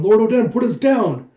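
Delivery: loud, high retold shout; urgent plea. Vassals beg their lord to put them down, urgent shout. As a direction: call out urgently, pitch well up.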